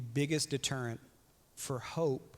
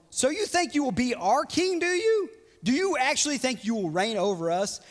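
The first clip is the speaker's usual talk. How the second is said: mocking brothers; 8 semitones higher, 6 dB louder than usual. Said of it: "His brothers responded".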